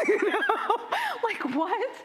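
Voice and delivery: high, loud, laughing hard; laughing disbelief. Real, not acted. Poet to audience on Campbells in Kingston's phone book, laughing disbelief. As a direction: crack up while asking, incredulous.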